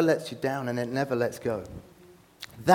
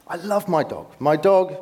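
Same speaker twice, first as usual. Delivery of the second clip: ordinary talk, nothing marked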